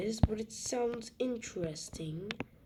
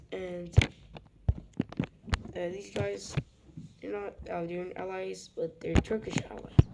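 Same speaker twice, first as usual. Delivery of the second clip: ordinary talk, nothing marked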